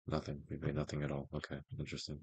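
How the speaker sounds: ordinary talk, nothing marked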